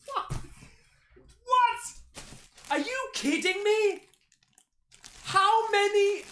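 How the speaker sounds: high, stunned what; can't believe how much is inside; shocked disbelief. Real, not acted. Vlogger stunned by his gift box contents, high shocked disbelief.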